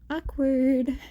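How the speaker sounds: plain reading voice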